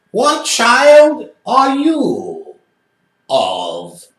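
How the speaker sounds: high, slow, otherworldly voice of the possessed man; eerie spirit voice. Retold or imitated. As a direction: stretch it out like a spirit speaking through him.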